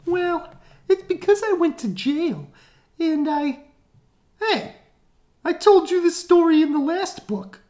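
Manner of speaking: very high and loud; high-pitched whiny complaint; whiny high villain. Acted.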